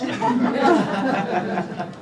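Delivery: a quick falling chuckle; short laugh. Real, not acted. Reading the comic to the crowd, short laugh. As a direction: laugh it off after the punchline.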